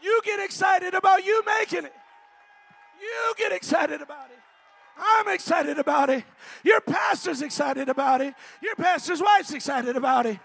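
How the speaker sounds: loud, urging preacher shout; full-force preaching. Real, not acted. Preacher urging congregation to get excited, full-force preaching. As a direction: rouse them, punching the start of each phrase.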